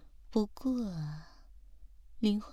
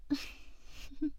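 teasing giggle; 6 semitones higher, 9 dB quieter than usual. Acted. Teasing roleplay laugh.

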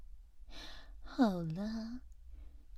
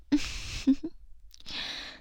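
teasing giggle; 6 semitones higher than usual. Acted. Teasing roleplay laugh.

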